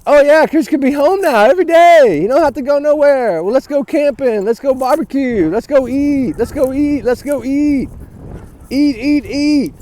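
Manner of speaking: sarcastic sing-song imitation of happy parents; hammed-up breezy chirp; mock carefree. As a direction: mockingly list the fun plans, one after another. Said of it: "happy paradise to them".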